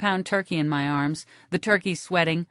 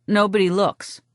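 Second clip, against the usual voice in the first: ordinary delivery, nothing marked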